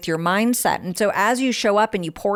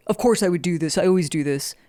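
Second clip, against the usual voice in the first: mock self-berating; 2 semitones lower than usual. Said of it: "calling ourselves stupid".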